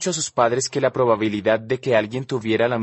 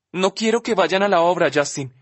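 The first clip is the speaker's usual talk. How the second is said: higher, cutting in impatiently; 6 semitones higher than usual. Acted. Olivia cutting Justin off, impatient. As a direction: interrupt him, impatient and insistent. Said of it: "me interrumpe impaciente".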